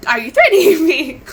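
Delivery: loud, high retold challenge with a laugh; incredulous outburst. Streamer retelling how they confronted him, incredulous outburst. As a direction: re-enact the outraged question, laughing midway.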